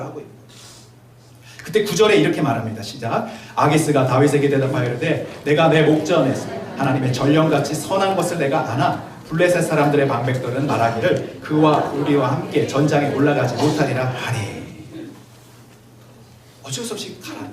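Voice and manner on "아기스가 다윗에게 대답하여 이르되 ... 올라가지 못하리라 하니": scripture reading. Acted. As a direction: read each verse out deliberately, like from the pulpit. Reads the chapter aloud.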